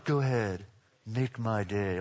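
low, soft tough-guy threat; Clint Eastwood. Acted. Preacher asking why Jesus won't talk tough, low Eastwood impression. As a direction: say it low, like a movie gunslinger. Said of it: "be like Clint Eastwood".

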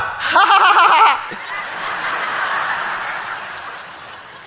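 high burst of laughter; big laugh. Real, not acted. Preacher laughing at his daughter's antics. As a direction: laugh hard at the memory.

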